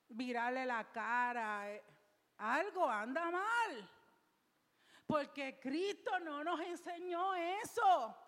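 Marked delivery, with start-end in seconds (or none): 2.4-8.2 loud, slow, high pulpit declaration; slow emphatic sermon peak; preaching. Acted. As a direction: hammer the conclusion home. Preaching climax.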